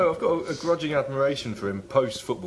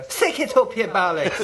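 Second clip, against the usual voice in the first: high, loud mock Irish yell; 11 semitones higher, 7 dB louder than usual. Retelling Roy Keane's insult to the manager, mock yell. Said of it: "And Roy said".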